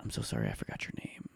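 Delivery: soft, sinking into a whisper; embarrassed hush. Retold or imitated. Neighbour forgetting his name, embarrassed hush. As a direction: apologize almost without voice.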